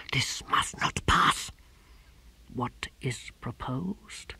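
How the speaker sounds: slow, unvoiced, cold whisper; quiet, dangerous authority; menacing whisper. A narrator's reading. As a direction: deacon Vorbis addressing the generals. Vorbis speaks in a whisper.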